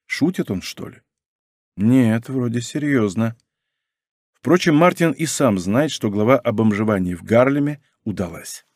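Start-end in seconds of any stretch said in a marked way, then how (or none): none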